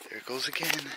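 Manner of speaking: usual speaking voice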